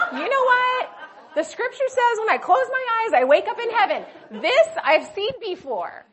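mother indignant she didn't wake in heaven, mock angry; feisty, almost angry. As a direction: play up her feisty outrage with a laugh behind it. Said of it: "I would almost venture to say angry".

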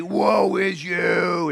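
high, very slow drawn-out wail of doom; fired-up prophet. Acted. Prophet repeating his warning in chapter three, fired-up cry. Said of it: "He's pretty fired up".

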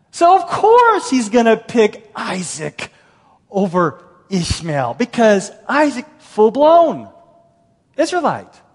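loud, high, slow and punched; emphatic objection. Acted. Fictional objector explaining God's choice of Isaac to Paul, emphatic. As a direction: declare it loudly, drawing out key words.

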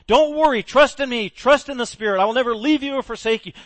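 loud, high, urgent reassurance; Jesus telling apostles not to worry; Jesus reassuring. Acted. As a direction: urge them not to fear. Jesus comforting apostles, voiced.

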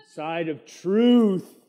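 slow, loud on "side of truth"; sermon emphasis on "truth"; drawn-out emphasis. Acted. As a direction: lean hard on "truth". Preacher stressing truth.

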